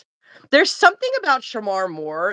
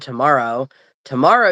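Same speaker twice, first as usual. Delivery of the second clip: ordinary talk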